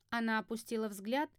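ordinary delivery

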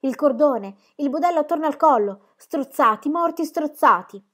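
put-on a shaken shepherd boy blurting out the horror; frightened telling. A narrator's reading. Shepherd boy tells pregnant woman of strangled lambs, frightened and loud. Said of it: "E tremava".

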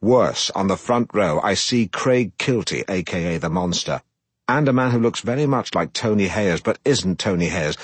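plain reading voice

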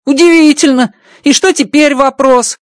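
high, bright-pitched alien voice, amazed; Rocky's alien voice. Acted. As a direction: play the little alien engineer, delighted. Rocky speaks, voiced by reader.

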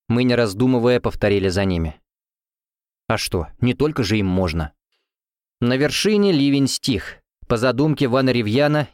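ordinary delivery

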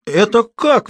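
high loud howl of outrage. A narrator's reading. Wife outraged her husband is sent away, howling. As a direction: howl it in disbelief. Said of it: "взвыла дама".

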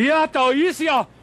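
high, loud sudden exclamation; startled hail across the yard; surprised call. Acted. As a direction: call out as someone appears. Someone arrives during break-in.

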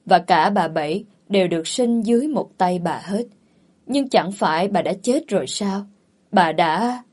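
ordinary delivery, nothing marked

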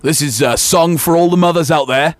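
booming radio DJ intro; morning radio dj. Shown as a demonstration. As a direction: announce it like a morning DJ. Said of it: "say it like a radio D J".

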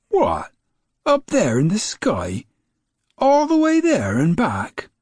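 amazed, climbing to a high squeak; astonished. A narrator's reading. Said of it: "utterly and completely amazed and astonished".

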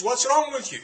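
mock angry outburst, pitched high; imitated raving anger. Preacher mimics a man told to drop his TV, mock mad. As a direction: act out an indignant man, voice jumping up. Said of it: "He's raving mad at you. He says".

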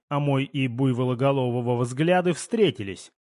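ordinary delivery

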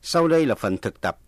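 ordinary delivery

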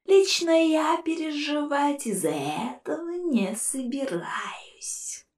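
soft, slow spectral sneer; whispered ghostly scorn; mocking ghost. A narrator's reading. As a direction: taunt them from inside the jar. Ghost skull voice.

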